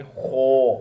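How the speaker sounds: "خو" loud, thick and throaty from the back; bold kho. Shown as a demonstration. Teacher shows students the heavy letter, bold throaty demo. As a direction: rasp out "خو" full and heavy. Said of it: "منہ کو فل mouth".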